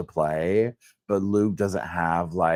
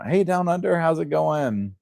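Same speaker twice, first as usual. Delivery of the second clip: singsong greeting; 9 semitones higher than usual. Real, not acted. Host hailing listeners Down Under, high singsong greeting. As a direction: call it out high, easing down at the end.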